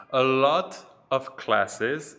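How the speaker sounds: A lot of classes, slow with lot stressed; slow and loud. Shown as a demonstration. Teacher reading the vocabulary item, slow and emphatic. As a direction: lean on lot and slow right down. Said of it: "teacher reads vocabulary item".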